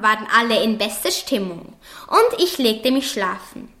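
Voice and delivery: plain reading voice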